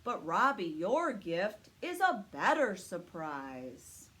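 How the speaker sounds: slow, loud, kindly Santa. Acted. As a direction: Santa praising the puppy, booming and unhurried. Santa speaks to Robbie.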